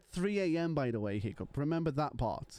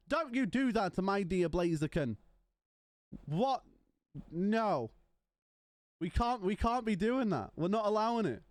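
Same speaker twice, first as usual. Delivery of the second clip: playfully scandalised, pitch lifted; mock indignant; 4 semitones higher than usual. Streamer defending dear Blaziken from chat, mock indignant. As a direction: protest in higher, mock-outraged tones.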